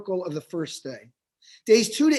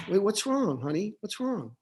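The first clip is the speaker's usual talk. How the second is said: softer, tender father soothing his son; gentle father; 5 dB quieter than usual. Retold or imitated. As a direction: soothe a crying little boy, softly. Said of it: "and his father says to him".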